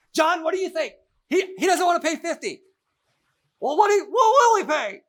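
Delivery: high, loud wife voice; high woman voice. Retold or imitated. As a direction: play the couple pitched high.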